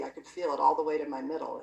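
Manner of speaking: ordinary talk, nothing marked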